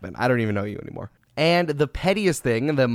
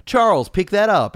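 loud, raised-pitch imitation of a command; retold impatient order; 9 semitones higher, 6 dB louder than usual. Jordan telling Barkley to pick up, retold loud order. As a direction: order him around, acting out the anecdote.

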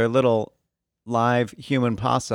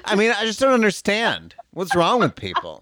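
prim indignant woman; 7 semitones higher than usual. Retold or imitated. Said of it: "She's like".

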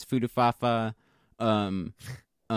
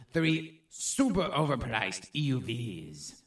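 French pirate; 2 semitones lower, 6 dB quieter than usual. Acted. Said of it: "Why am I sounding like a fucking French pirate?".

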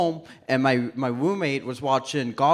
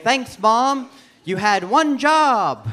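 raised pitch, drawn-out mock reproach; mock complaint; 8 semitones higher, 4 dB louder, slower than usual. Comic blames mom for his hard-to-say name, mock reproach. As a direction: chide Mom in jest, stretching the last words.

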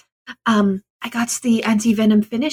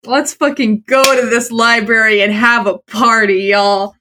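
ordinary delivery

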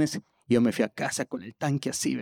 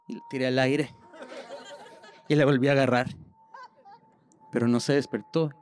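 normal talking voice

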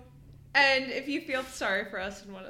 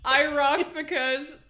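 higher, louder put-on caller voice; disguised voice; 5 semitones higher, 7 dB louder than usual. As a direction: fake a listener's voicemail in a disguised voice. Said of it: "I'll do, like, a disguised voice".

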